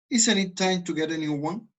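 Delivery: "Isn't it time to get a new one?" rising high at the end; rising intonation. Shown as a demonstration. Teacher contrasting the question tune. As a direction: lift the question up. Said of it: "miren cómo es un rising intonation".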